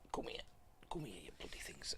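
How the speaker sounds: muttered whisper while fumbling; hushed exasperated whisper to himself. Real, not acted. Fumbling for books on camera.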